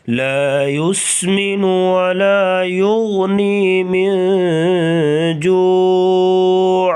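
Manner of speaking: slow, drawn-out Quranic chant; Quran recitation. Shown as a demonstration. Teacher reciting the verses slowly for students. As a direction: chant each word slowly and evenly.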